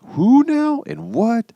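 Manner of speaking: high, slow, bewildered question; baffled kid. Retold or imitated. Stretched-out confused whine. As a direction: kids asking, lost among relatives, in high baffled voice. Kids confused by family names.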